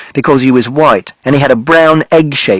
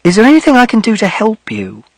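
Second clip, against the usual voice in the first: gentle offer; 7 semitones higher than usual. Acted. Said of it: "she said".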